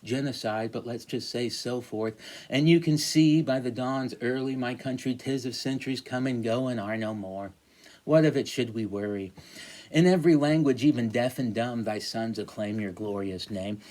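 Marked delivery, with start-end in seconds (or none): 2.5-13.8 declaiming with mock grandeur; reading a poem. Teacher reading the sonnet aloud to class, mock-grand. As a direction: read it like a pompous anthem.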